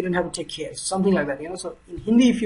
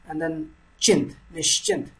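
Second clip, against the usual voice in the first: "nischint" said much higher, split nish then chint; high demo; 11 semitones higher than usual. Shown as a demonstration. Tutor exaggerating the two parts of "nischint" for learners. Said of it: "read this part once".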